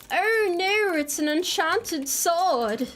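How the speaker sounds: high, drawn-out mock dismay; oddly theatrical. Streamer spotting an enchanted sword, mock-theatrical dismay. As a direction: stretch it out in a high put-on voice. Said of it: "I don't know why I said it like that".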